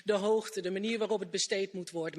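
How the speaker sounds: ordinary talk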